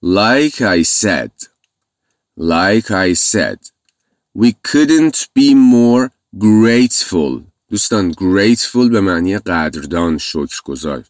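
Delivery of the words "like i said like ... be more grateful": very slow, deliberate repetition; slow model reading. Shown as a demonstration. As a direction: model it slowly for the class. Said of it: "همونطور که گفتم".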